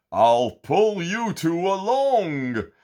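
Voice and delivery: high, slow, loud helpful giant; sea giant voice. A narrator's reading. Sea giant offering to pull the friends along, slow and loud. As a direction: offer help in a big friendly voice.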